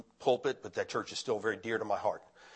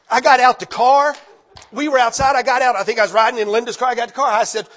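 excited; 12 semitones higher, 13 dB louder than usual. Real, not acted. Excited retelling of snowy arrival.

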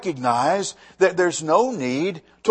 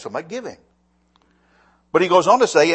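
ordinary talk, nothing marked

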